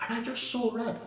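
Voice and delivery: high, heated recounting; still angry retelling. Real, not acted. Telling the host about the driver, still heated and high. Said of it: "I was so angry".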